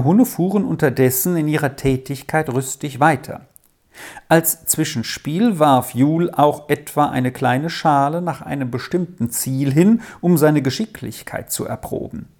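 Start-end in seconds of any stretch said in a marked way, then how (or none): none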